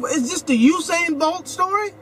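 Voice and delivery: ordinary talk, nothing marked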